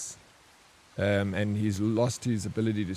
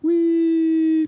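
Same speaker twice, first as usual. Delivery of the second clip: gleeful wee; 5 dB louder than usual. Pretend. Imagined flying over the border.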